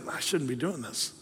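very soft, low inner voice; still small voice. Acted. Preacher voicing a check in your spirit, hushed. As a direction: say it under the breath, like a thought. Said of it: "that's the still small voice".

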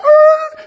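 sudden high loud cry of alarm; little driver yelping in fright; startled yelp. Retold or imitated. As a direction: yelp in shock at the huge man. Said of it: "he's like".

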